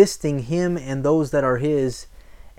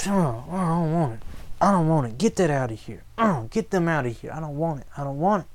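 dismissive refusal; 4 dB quieter, faster than usual. Acted. Acts out people refusing God's way.

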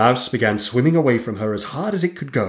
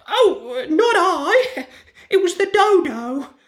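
very high, squeaky laughing duck; giggling duck; slower than usual. A narrator's reading. Duck denies speaking, very high and giggly.

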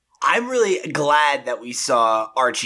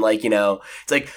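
normal talking voice

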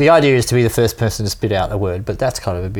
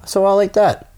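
naive self quote; 11 semitones higher than usual. Retold or imitated. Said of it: "kind of going".

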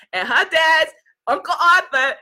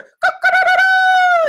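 rooster crow; 7 dB louder than usual. Retold or imitated. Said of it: "here comes the rooster".

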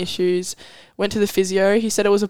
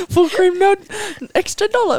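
quoting milk ad; 11 semitones higher than usual. Retold or imitated. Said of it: "But it's part of the ad".

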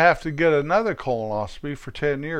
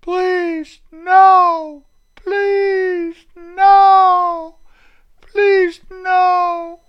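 high, squealing cries of please no, louder on each no; mock pleading victim; 8 dB louder, much slower than usual. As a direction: beg like a terrified hostage, hammed-up. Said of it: "You're going".

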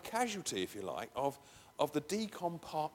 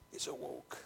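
"It's a walk." almost hushed; hushed repeat; softly; 9 dB quieter than usual. Shown as a demonstration. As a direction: drop to a soft echo of "It's a walk.". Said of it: "repeated softer".

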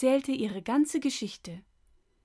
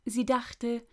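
ordinary delivery, nothing marked

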